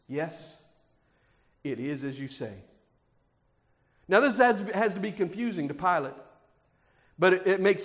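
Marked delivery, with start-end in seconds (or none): 0.1-2.5 quiet sure answer. Acted. Jesus' quiet answer retold.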